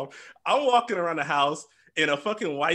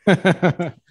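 laughing; 7 dB louder, much slower than usual. Real, not acted. Telling friends an embarrassing story, laughing. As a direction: laugh it out as you wrap up.

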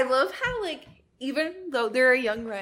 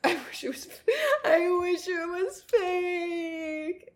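slow, higher fake crying; mock sob; 8 semitones higher than usual. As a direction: whine it like a melodramatic sob. Mock crying over cancelled fic.

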